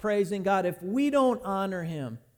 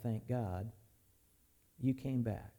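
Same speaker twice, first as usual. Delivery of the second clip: under her breath; 7 semitones lower, 10 dB quieter than usual. Retold or imitated. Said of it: "under her breath".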